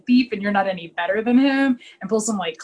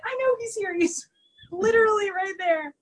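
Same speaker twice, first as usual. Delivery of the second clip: exasperated; 10 semitones higher than usual. Retold or imitated. Latimer exasperated at panicking Dubenich.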